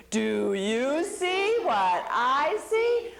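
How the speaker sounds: slow, sly singsong lure; sly singsong Anansi. Acted. Anansi luring Little Bush Deer to the rock, slow sly singsong. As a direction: draw the question out like bait.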